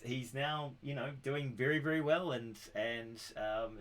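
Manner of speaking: usual speaking voice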